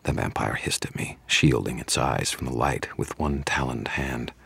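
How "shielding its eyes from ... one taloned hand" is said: plain reading voice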